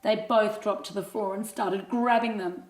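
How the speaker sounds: ordinary delivery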